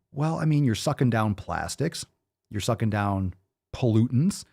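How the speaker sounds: ordinary talk, nothing marked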